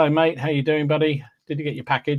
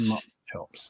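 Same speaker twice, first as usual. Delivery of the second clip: quiet, fond repeat of Mutton Chops, softer and lower; savouring the name; 4 semitones lower, 10 dB quieter, faster than usual. Real, not acted. Host relishing a viewer's funny name, soft and fond. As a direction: murmur Mutton Chops back to yourself, relishing it. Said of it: "I love that".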